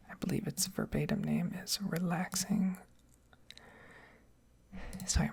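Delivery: ordinary talk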